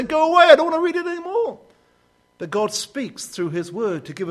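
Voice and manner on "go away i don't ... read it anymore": high, loud, sulky pushing away; a reluctant reader telling the Bible to go away, sulky and high; petulant refusal. Retold or imitated. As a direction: whine it like a child shutting the book. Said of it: "I want to shut it and say".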